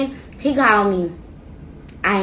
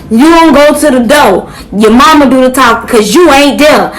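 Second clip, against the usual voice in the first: stern dad; 3 semitones higher, 10 dB louder than usual. Retold or imitated. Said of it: "So my daddy like".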